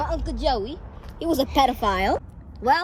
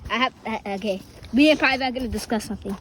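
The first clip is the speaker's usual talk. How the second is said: usual speaking voice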